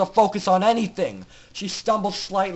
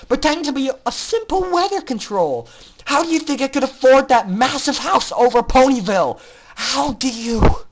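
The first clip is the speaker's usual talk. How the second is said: loud, higher furious rant; villainous mare rages at her former pupil; screaming in rage; 5 semitones higher, 4 dB louder than usual. Acted. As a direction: spit the betrayal back at her. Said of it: "she screamed in return".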